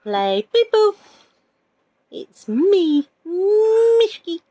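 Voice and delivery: high squeaky pet voice; cute pet voice. Pretend. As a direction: speak as a tiny hamster. Speaking as the hamster Mishki.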